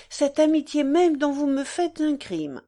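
usual speaking voice

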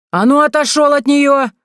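high furious yell from a tree. Acted. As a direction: scream at him to back off. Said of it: "заорала с дерева".

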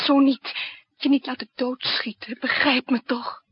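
loud pleading; loud plea. Acted. Lillet pleading with the narrator. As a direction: beg to be understood.

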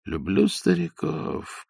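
softly, slowly, under the breath; whispered. A narrator's reading. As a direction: say it softly to yourself, amused. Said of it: "прошептал Остап".

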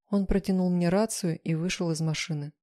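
ordinary delivery

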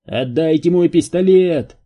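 loud, high-pitched furious demand; angry yell. A narrator's reading. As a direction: yell the order in anger. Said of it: "заорал Кэйн". (злобно заорать)

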